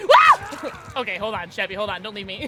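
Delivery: loud, high pleading cries, rising; laughing desperate pleading; laughing panic. Real, not acted. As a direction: beg your teammate not to leave. Begging teammate not to leave.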